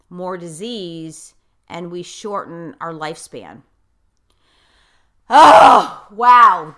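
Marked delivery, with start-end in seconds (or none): 5.3-6.7 loud surprised exclamation. Real, not acted. Reacts to deep remark.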